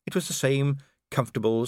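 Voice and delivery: ordinary delivery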